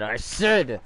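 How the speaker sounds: acted angry outburst, rising to a shout; riled-up. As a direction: land 'enough' high and loud. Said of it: "he's mad. He's getting riled up".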